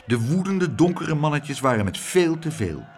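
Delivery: ordinary delivery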